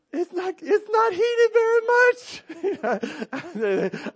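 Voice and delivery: very high, loud squeal of cold shock. Retold or imitated. Dad retelling the chilly hotel pool in a very high, loud squeal. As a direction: squeal as the cold water hits. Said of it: "I'm like".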